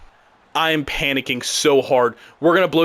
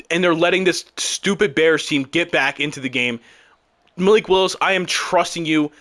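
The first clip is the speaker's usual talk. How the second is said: ordinary talk, nothing marked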